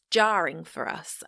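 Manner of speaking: jarring said slowly and pushed; stress on jarring. Real, not acted. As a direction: lean into jarring, draw it out. Emphasises the key word.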